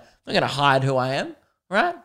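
usual speaking voice

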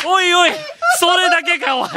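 loud high laughing protest; laughing indignation. Real, not acted. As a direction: cry out in laughing dismay. Punchline, fans ignore him.

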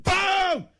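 high-pitched shouted impact; explosive boom. Retold or imitated. Acts the exploding ball hit.

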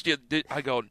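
ordinary delivery

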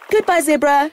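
calling after the zebra, high; called out. A narrator's reading. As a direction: call it out as it runs. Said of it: "shouted Oki".

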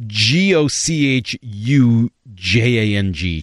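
slow, deliberate letter-by-letter spelling. Real, not acted. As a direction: spell out each letter slowly. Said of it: "don't know how to pronounce this word".

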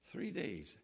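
low, very soft echo; hushed repeat. Shown as a demonstration. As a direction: drop it right down for weight. Repeats the hardship for effect.

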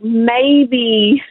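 usual speaking voice

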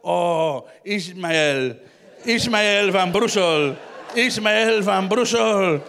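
long, labored ecstatic calls; mock climactic calling. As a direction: stretch every vowel as if swooning. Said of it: "En ofwel roept je".

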